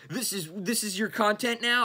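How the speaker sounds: high, incredulous sneer; mocking commenter. Quoting viewer comments, mocking disbelief. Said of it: "I've gotten so many fucking comments like that".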